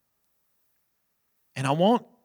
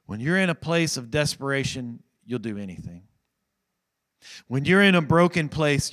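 usual speaking voice

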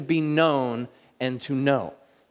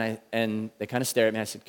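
ordinary talk, nothing marked

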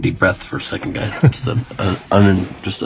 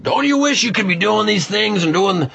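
high imitation of the editor; 12 semitones higher than usual. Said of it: "she's like".

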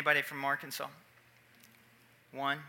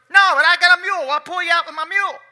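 country farmer; 11 semitones higher, 11 dB louder, faster than usual. Retold or imitated. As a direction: play the easygoing mule farmer. Farmer voiced in story.

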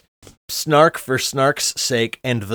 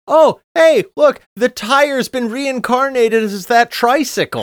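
mock excitement; 11 semitones higher, 4 dB louder than usual. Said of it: "he then says".

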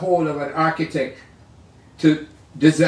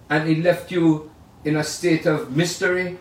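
ordinary delivery

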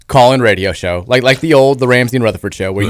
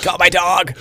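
movie character impression; 5 semitones higher, 5 dB quieter, slower than usual. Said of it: "the guy from Independence Day".

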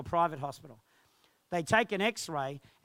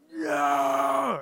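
huge, sustained high roar; loud roaring yell; lion-like roar; 8 semitones higher, 8 dB louder than usual. Retold or imitated. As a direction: let out a long, full-force roar. Demonstrates his roar of a lion.

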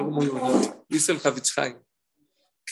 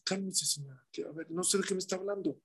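calming driver; 9 dB quieter, faster than usual. Retold or imitated. Driver calming shouting woman.

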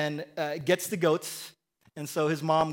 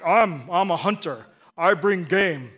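loud, slow man's man; Esau; 6 dB louder, slower than usual. Acted. Esau boasting that he brings game, man's man voice. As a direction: play up the macho hunter, loud and deliberate. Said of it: "this is how I picture Esau's voice".

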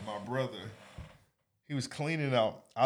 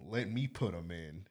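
conniving; 4 semitones lower, 8 dB quieter than usual. Retold or imitated. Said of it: "this sound conniving as fuck".

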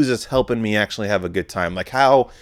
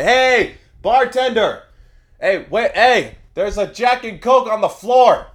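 loud, high call across the bar; hollering for the bartender over the noise; 12 semitones higher, 5 dB louder than usual. Retold or imitated. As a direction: shout up high to get the bartender's attention.

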